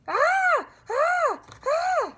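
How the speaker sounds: piercing high screams; scary scream. Acted. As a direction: scream to scare Grandma. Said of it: "I screamed".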